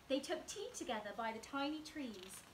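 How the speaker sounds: plain reading voice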